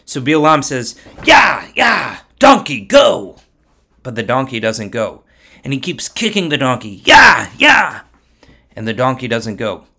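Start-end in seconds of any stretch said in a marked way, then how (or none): 1.2-3.4 high, loud cries to drive an animal on; acted mule-driver's yell; urging shout. Bilam urges on stubborn donkey. 7.0-8.0 high, loud cries to drive an animal on; acted mule-driver's yell; urging shout. Bilam kicks the donkey on.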